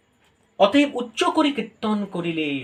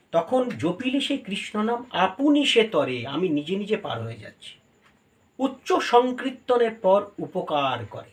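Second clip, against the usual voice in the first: ordinary talk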